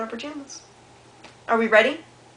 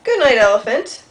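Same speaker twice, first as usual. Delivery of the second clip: loud cheery good night; bright called-out good night; calling good night; 2 semitones higher, 13 dB louder than usual. Acted. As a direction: call it out to the elephant. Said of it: "Zookeeper Joe says".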